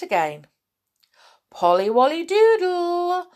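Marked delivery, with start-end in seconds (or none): 1.5-3.3 high, loud sing-out call; shouting. A narrator's reading. Big bear shouting down the mouse hole, shouting. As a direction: holler it down the hole. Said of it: "shouted the big bear".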